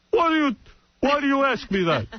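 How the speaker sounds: dad bristling at the question; father rebuffing son's question; suspicious dad. Retold or imitated. Dad snapping at son.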